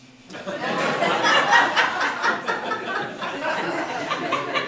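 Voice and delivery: long loud laugh at his own joke; real amused laugh. Real, not acted. Joke about cell phones.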